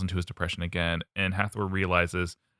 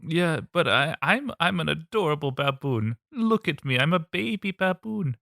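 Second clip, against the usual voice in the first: high cutesy baboon voice; 8 semitones higher than usual. Pretend. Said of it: "shows up as a baboon".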